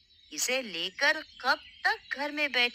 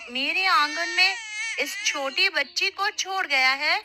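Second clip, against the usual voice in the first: surprised call; 7 semitones higher, 5 dB louder than usual. Acted. Woman finds abandoned baby girl.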